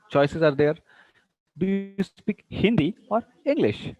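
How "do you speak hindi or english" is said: slow, higher, "Hindi" lifted and "English" dropped; rise to fall. Shown as a demonstration. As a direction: pose the choice with a climb on "Hindi", fall on "English". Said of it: "Hindi, rising, and English, falling".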